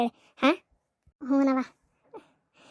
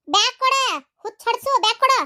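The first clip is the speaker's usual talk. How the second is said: much higher, shrieking demand; high angry yell; shouted demand; 7 semitones higher than usual. Acted. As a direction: scream for the bag. Grabbing for the bag.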